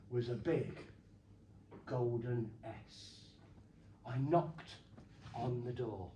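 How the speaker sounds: low, soft, slow spooky storytelling; hushed suspense. Acted. Telling the kids a spooky story, low and hushed. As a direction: draw the crowd in with a hush.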